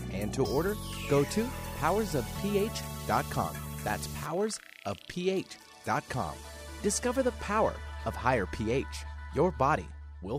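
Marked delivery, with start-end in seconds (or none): none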